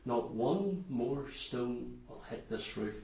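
low, hushed imitation of a praying preacher; very quiet. Evangelist commanding the stones to stop, very quiet. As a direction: barely raise the voice, deep and deliberate. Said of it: "he says very quietly".

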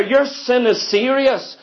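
normal talking voice

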